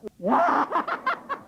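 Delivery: high, loud laugh; burst of laughter. Real, not acted. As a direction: laugh out in delight. Child suddenly appears healed.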